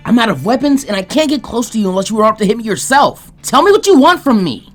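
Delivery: high, loud desperate yell; angry shout. Acted. Naruto yelling at Jiraiya, desperate and furious. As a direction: scream it out at the end of his rope. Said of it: "he shouted".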